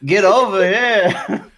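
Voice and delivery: loud, high, leering come-on; leering old lecher. Retold or imitated. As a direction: leer and holler like a dirty old man. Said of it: "I'm like".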